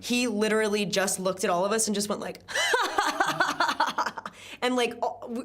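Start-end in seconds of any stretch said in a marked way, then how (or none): 2.4-4.3 high, forced burst of mimicked laughter; fake laugh. Actor mimicking a co-star at the top of the take, fake laugh. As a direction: imitate a sudden put-on laugh. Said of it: "laughing at his fake laugh".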